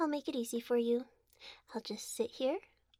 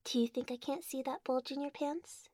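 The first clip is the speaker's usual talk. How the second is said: ordinary talk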